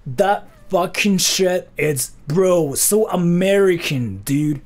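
American bro voice. Acted. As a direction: play the clueless American bro. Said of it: "that's what I think Americans sound like".

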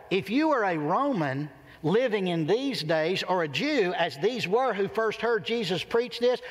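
usual speaking voice

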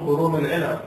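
ordinary delivery

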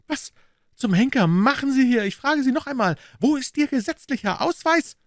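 high-pitched angry yelling; furious shouting. A narrator's reading. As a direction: yell an outraged demand at the intruders, voice pushed way up. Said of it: "schrie der wütende Peters". (wütendes, hohes Schreien)

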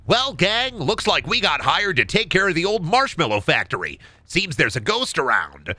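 swinging, wholesome cartoon leader; brief the gang in a cartoon voice. Leader tells the gang about a ghost, cartoon earnest. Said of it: "can you ad-lib a Scooby-Doo episode".

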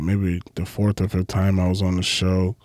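normal talking voice

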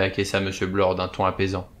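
ordinary delivery

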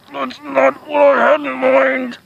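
high, slow, drawn-out whine; pained wail. Acted. Host under producer's rock, high pained wail. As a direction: wail the complaint slowly at high pitch.

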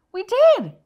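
high, loud cheerful shout; excited cheer. Shown as a demonstration. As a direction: cheer it like a proud teacher. Teacher cheers answer.

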